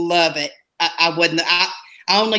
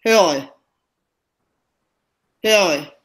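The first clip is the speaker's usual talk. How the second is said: word said with tongue held still; holding tongue with fingers. Shown as a demonstration. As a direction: say it without moving the tongue. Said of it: "I'm fixing to say a word holding my tongue".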